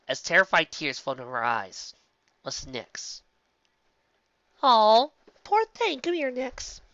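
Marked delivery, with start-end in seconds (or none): 4.6-6.8 high, drawn-out acted pity; cooing sympathy. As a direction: coo over a frightened child in a raised voice. Acted character coos over frightened filly.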